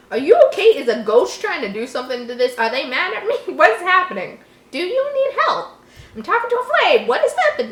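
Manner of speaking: high, loud startled questions. Real, not acted. Reader startled by a flickering candle flame. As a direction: jump at the flame and ask what's wrong.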